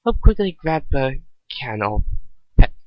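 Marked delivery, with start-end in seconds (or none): none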